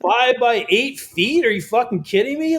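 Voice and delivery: disbelief. Retold or imitated. Reacting to print size.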